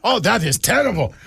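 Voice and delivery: laughing, lightly put-on bad Russian accent; mock Russian accent, breaking into laughter. Imagined Russian hacker judging a photo, bad Russian accent. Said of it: "That's a bad Russian accent".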